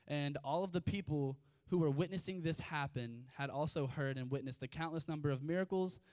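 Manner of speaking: usual speaking voice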